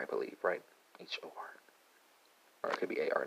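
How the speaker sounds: unsure whisper, barely voiced; whispered aside. Real, not acted. Teacher doubting the spelling, whispering to himself. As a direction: drop to a breathy private whisper.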